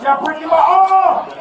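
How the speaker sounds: pained, slow cry of protest; drawn out in real pain; arm twisted back. Real, not acted. Arm twisted during restraint.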